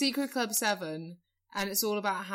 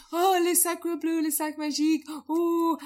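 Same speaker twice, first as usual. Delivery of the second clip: put-on French accent; 8 semitones higher, 6 dB louder than usual. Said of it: "everyone in Cannes is like".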